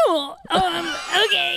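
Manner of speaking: high, shaky fake composure; choked-up okay. Cut player pretending it's fine to the coach. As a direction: force it out while holding back tears. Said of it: "And I'm like".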